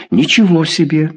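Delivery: высокий удивлённый возглас; ахнуть от удивления; ахнув. A narrator's reading. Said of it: "ахнул Порогин". (ахнул, заметно выше)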